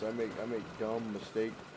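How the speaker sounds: ordinary talk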